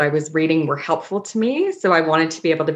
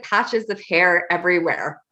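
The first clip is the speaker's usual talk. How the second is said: ordinary talk, nothing marked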